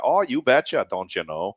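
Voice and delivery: hammed-up Fargo accent, dropping off at the end; playful Fargo impression. Shown as a demonstration. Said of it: "I'm gonna try this real quick".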